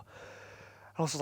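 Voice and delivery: breathy, tired voice, barely voiced; huffing and puffing. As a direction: wheeze it out like you're dragging yourself out of bed. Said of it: "huffing and puffing".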